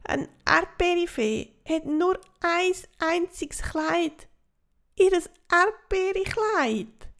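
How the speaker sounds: high, wailing fairy voice, slowing at the end; a small fairy lamenting her lost dress; high plaintive fairy lament; whiny little fairy. Acted. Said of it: "jammert das Erdbeerindli".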